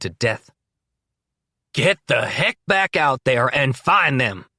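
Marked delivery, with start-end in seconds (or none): 1.7-4.4 loud, slow furious command; screaming order. A narrator's reading. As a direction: scream it at the men. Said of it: "Danny Rosewood screams".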